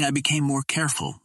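usual speaking voice

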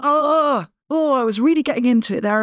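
imitating herself being interrupted, lightly peeved; annoyed. Recalling being stopped mid-workout, mildly annoyed groan. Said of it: "I'm, like, annoyed".

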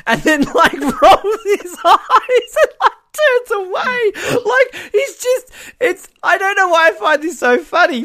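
high, squeaky laughing fit; really cracking up, words stretched and squealed; helpless laughter. Real, not acted. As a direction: barely get the words out for laughing. Cracking up while retelling the scene.

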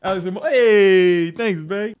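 startled interruption. Real, not acted. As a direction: caught off guard, then grateful. Startled by partner handing something.

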